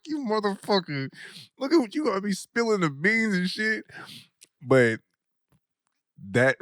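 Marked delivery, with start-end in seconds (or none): 0.1-3.9 mock accusing friend. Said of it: "I was like".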